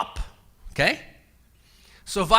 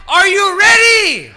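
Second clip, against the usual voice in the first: louder, higher rallying shout; hype call; 7 semitones higher, 8 dB louder than usual. Real, not acted. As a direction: pump the crowd up. Hyping up the crowd.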